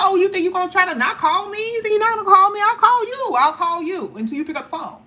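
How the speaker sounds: imitating a controlling family member on the phone; mock bullying parent. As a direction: mimic a pushy relative threatening to keep calling. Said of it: "They be like".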